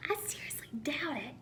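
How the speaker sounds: a breathless whispered sneer; whispered snark. Acted. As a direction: hush it like a snide aside. Second snake, whispered sneer.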